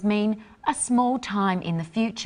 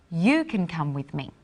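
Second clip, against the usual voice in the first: "You" stressed at the start, like giving an order; single out "You", then let the rest drop; 3 semitones lower than usual. Shown as a demonstration. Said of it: "more like an order".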